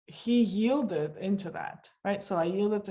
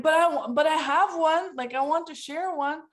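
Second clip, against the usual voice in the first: louder, higher pleading; mock eager plea; 8 semitones higher, 6 dB louder than usual. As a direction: beg eagerly for a turn. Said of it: "he's like".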